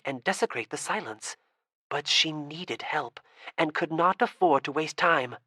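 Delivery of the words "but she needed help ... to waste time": ordinary delivery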